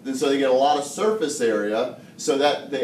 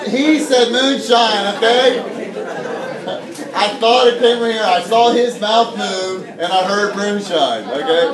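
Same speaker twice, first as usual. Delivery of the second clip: high, loud self-defence to a rowdy room; loud protest; 7 semitones higher, 6 dB louder than usual. Real, not acted. Teacher defending what he heard to a giggling class, loudly protesting. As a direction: insist at raised pitch and volume that you heard right.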